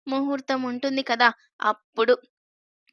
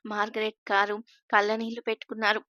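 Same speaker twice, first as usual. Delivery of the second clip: usual speaking voice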